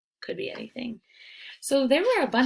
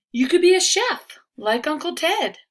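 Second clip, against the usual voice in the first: louder, higher, eager pitch; enthusiastic suggestion; 9 semitones higher, 8 dB louder than usual. Acted. Cousin pitching a chef job to Riley, bright and eager. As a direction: sell the idea with gusto.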